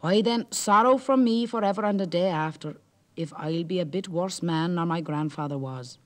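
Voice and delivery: mild defiant vow; lightly voiced bold Jack. Jack swears to the Merrow, mildly bold.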